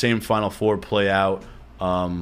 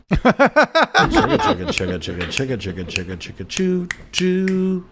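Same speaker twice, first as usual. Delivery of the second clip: train sounds; 7 semitones higher than usual. Pretend. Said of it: "That's me".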